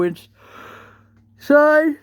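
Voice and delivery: talking through a yawn. Real, not acted. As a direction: yawn while speaking. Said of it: "Sorry for the yawning".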